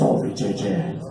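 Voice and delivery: low, soft seductive purr; sultry caller. Retold or imitated. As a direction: breathe it like a creepy come-on. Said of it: "And he goes".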